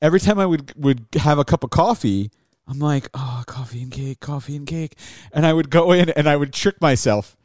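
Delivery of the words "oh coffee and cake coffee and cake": soft, lower musing to himself; quiet craving. Retold or imitated. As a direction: murmur the temptation inwardly. Acting out his craving to himself.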